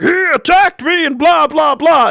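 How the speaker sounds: mock the guy's whining, high and loud; whiny mock tattletale. Mocking the biker to cops.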